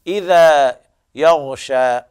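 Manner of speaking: slow, drawn-out recitation of إذا يغشى with the غ of يغشى heavy; ترتيل بطيء; tajweed demo. Shown as a demonstration. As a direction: chant إذا يغشى slowly, giving full weight to the غ in يغشى. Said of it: "يغشى هكذا".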